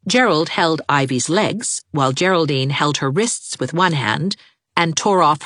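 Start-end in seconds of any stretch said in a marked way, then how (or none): none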